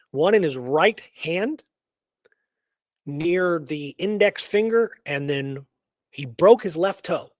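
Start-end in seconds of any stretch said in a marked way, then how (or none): none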